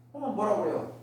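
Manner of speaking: ordinary delivery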